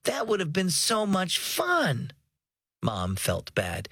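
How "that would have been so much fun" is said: disappointed. A narrator's reading. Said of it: "Aubrey wailed".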